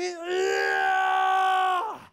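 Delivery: high loud victory cry; triumphant yell. Acted. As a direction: whoop as he catches him. Creator grabs the coyote.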